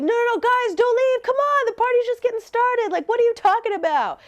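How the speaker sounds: put-on high-pitched protesting drunk friend; mock whiny plea, held high; drunk party-goer. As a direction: imitate someone begging the party to continue. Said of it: "ready to party again".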